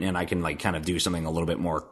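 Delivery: ordinary delivery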